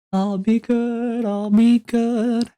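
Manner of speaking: high, slow, drawn-out mock whimper; mock pleading. Promising to be good in a high, slow whimper. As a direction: beg like a child promising. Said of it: "self-reassuring".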